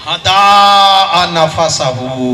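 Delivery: हदा नफसहू stretched slowly at normal pitch; slow recitation. Shown as a demonstration. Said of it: "क्या कहा?".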